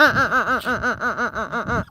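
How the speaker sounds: ordinary talk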